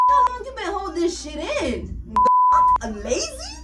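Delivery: high, loud offended disbelief; indignant outrage. Retold or imitated. Cashier furious at supervisor calling her lazy, loud. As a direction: throw her words back at her, appalled.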